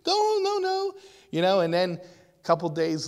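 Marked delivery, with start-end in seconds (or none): 0.0-0.9 much higher pleading voice, a bit louder; pleading with a dog. Acted. You calling after a wild dog running away, high pleading. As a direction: call after it in a high pleading voice, don't scare it. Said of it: "and you're like".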